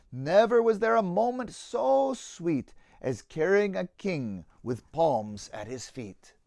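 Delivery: put-on lively rhyming read-aloud for children; story narration. A narrator's reading. Narrator reading a children's Easter tale, lively storytelling. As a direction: tell the tale with bounce and warmth in the rhythm.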